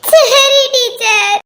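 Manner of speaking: tearful, very slow pupil's reply; child sobbing to the teacher; crying. Acted. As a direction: agree through acted sobs. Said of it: "இப்படி அழக்கூடாது".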